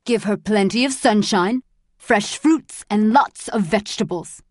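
loud, jeering guard's voice; sarcastic, forceful order; mocking sick joke. A narrator's reading. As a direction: toss it out loudly as a cruel joke. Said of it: "He laughed at his own sick joke".

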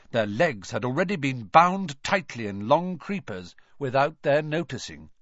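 usual speaking voice

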